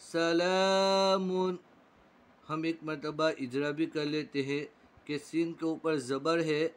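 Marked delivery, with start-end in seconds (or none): 0.0-1.6 सला with ला held long; stretched ला. Shown as a demonstration. Teacher stretching ला in सला for pupils. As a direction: draw out the second syllable slowly. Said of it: "खींचकर पढ़ेंगे".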